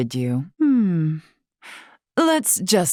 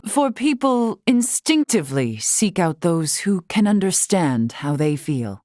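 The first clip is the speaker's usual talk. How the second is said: usual speaking voice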